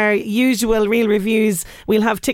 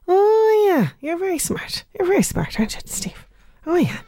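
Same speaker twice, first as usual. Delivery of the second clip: high, sarcastic "aren't you clever"; mock praise; 8 semitones higher than usual. Radio host teasing a listener who guessed tomorrow's answer, mock praise. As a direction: tease the texter in a higher, softening voice.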